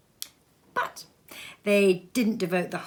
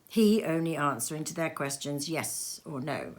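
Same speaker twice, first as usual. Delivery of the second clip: ordinary delivery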